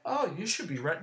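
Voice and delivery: mocking mimicry. Imitating people who expect him at home, mocking. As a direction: parrot them in a mocking voice.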